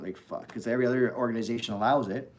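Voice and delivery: normal talking voice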